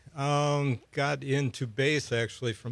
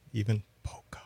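dropped, softer voice; near-whispered punchline; hushed confession; 3 semitones lower, 5 dB quieter, faster than usual. Real, not acted. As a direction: say it softly, like an embarrassing admission. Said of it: "don't even have to whisper that".

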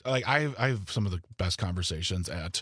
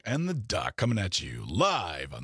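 radio announcer voice; 3 dB louder than usual. Shown as a demonstration. Co-host demonstrates the radio voice for the duo, big announcer delivery. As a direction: sell the show name like a hyped radio host. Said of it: "a better radio voice".

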